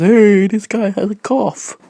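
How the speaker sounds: a hint of a friend spreading news; mock friend voice. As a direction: play the friend telling others, slowly. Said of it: "Tell your friends".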